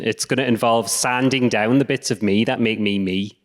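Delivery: ordinary talk, nothing marked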